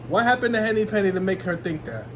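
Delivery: ordinary delivery, nothing marked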